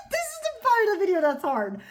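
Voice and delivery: high laugh spilling into the words. Real, not acted. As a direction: laugh at her own struggle. Laughs at forgetting names.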